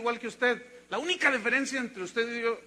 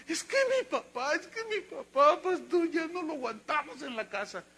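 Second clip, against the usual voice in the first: put-on higher, pleading girl's voice; voicing the crying girl; 7 semitones higher than usual. Girl telling her pastor about her father, higher and pleading. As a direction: plead like a tearful young girl. Estaba llorando la niña.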